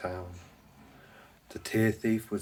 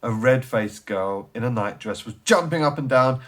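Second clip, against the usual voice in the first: plain reading voice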